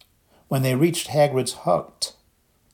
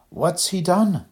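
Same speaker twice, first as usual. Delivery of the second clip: nervous; 7 semitones higher, slower than usual. A narrator's reading. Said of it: "said Harry nervously".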